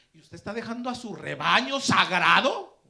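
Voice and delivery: loud accusing outburst, swelling on the last words; indignant rebuke. Retold or imitated. Deacon scolding the pastor for leaving his flock, indignant. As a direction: thunder it like an outraged church elder.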